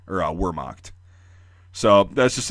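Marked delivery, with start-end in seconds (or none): 0.3-0.9 Wehrmacht as WUR-mokt, lower voice; English W and hard R in Wehrmacht; mispronounced English W. Shown as a demonstration. Showing the version less understood, Wehrmacht low with English W. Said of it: "understand you better than saying".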